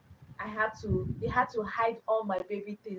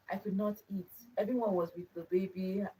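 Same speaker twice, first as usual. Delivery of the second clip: ordinary talk, nothing marked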